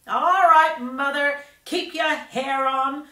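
loud, high shout at Mother. A narrator's reading. Girl shouting across the room at her mother, loud and high. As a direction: yell back at her mother, slow on the last words. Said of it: "Miss Beauregard shouted".

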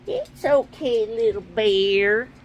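ordinary talk, nothing marked

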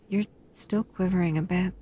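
ordinary delivery

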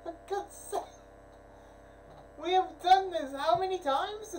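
loud, high, slow complaint; exasperated. Real, not acted. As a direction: sigh out the frustration.

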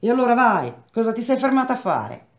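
impatient shout. Acted. Impatient man sending messenger off.

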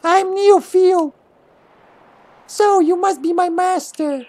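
slow, very high squeaky creature voice; Neofil. Acted. Creature introducing itself to its new master, squeaky voice. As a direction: squeak each word out in a drawn-out falsetto. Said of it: "You gotta give it a squeaky voice".

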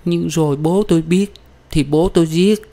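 high-pitched, tearful voicing of a woman; high whimpering worry. A narrator's reading. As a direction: fret through acted tears. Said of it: "Giọng Đào thúc thích qua nước mắt".

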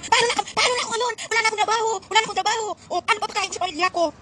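high, whiny pleading mimicry; mock whining celebrity. Mocking the celebrity who lost his job, high whiny plea. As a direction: ham it up like a pampered star begging. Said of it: "Sabi niya".

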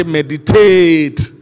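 slow, drawn-out loud shout. Shown as a demonstration. Preacher showing the congregation how to say it, loud shout. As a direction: shout it loud, stretching the last syllable. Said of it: "No, shout it loud and say".

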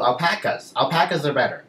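loud, hammed-up pupil's answer; exaggerated character voice. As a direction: declare it loudly for dramatic effect. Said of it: "characterizing my voice more so that the story".

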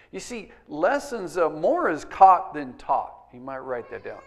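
ordinary talk

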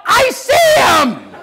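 drawn-out, high-pitched holler. Retold or imitated. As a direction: holler it like a child making a sighting. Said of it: "hollered out".

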